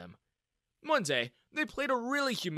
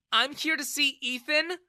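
high, raised indignant voice; angry reply; 12 semitones higher, 6 dB louder than usual. A narrator's reading. Daughter confronting her father, angry. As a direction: answer him back crossly. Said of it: "she replied angrily".

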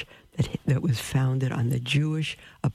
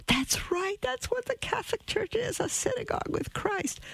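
imitated eager brother, much higher and softer; excited brother; 5 dB quieter than usual. Brother happily confirming her shock, imitated high and eager. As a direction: mimic his delighted agreement in a high voice. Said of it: "he got all excited".

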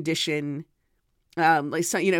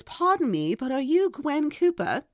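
lightly put-on British accent, higher and slow; a hint of a polite British fan; 8 semitones higher than usual. British fan politely asks the author her name, light British accent. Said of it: "I'm not doing a great British accent".

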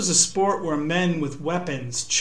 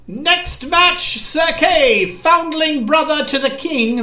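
much higher, louder announcer's call; herald's shout; 10 semitones higher, 6 dB louder, slower than usual. A narrator's reading. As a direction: proclaim the match to the crowd, the end slower. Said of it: "Keith heard a voice shout".

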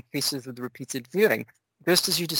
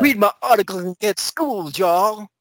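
higher, louder hammed-up rapper boast; mock rapper; 6 semitones higher, 3 dB louder than usual. Host voices Benjamin as rapper, hammed-up boast. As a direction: brag it like a rapper. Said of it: "finally sounding like a rapper".

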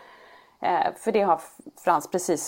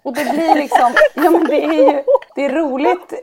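real laughter through the words; giggling while talking, higher and louder; laughing at her son's swearing; 6 semitones higher, 8 dB louder than usual. Real, not acted.

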